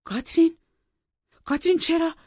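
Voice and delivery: high, loud cry of her name; calling his wife's name in alarm. Acted. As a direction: call out to her in shock. Master calls out to his wife.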